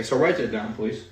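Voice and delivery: normal talking voice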